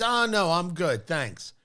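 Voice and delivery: ordinary talk, nothing marked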